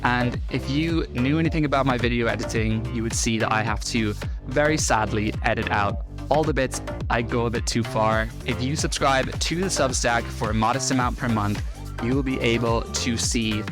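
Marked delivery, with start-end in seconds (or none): none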